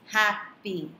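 "Happy" split as hap, py; syllable by syllable. Shown as a demonstration. Teacher dividing the word, "Happy" in two beats. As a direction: gap between the halves of "Happy". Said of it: "it is divided into two syllables".

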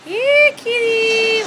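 calling a lost pet. Real, not acted. Calling the missing cat.

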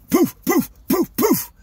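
loud, high percussive poofs; imitated spray puffs. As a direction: mimic forceful bursts from a spray bottle. Imitates spraying away droppings.

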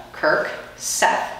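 plain reading voice

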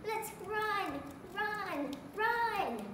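child playing a pumpkin urging everyone to run; excited pumpkin cry. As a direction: sound the alarm like a scared pumpkin. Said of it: "one said".

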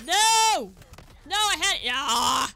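loud, high cry of dismay; real frustrated yelling; anguished shout. Real, not acted. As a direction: yell out in disbelief at the death. Dies in game.

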